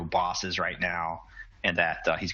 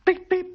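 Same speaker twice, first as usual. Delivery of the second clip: comlink beep; 9 dB louder than usual. Acted. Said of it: "Hangs up".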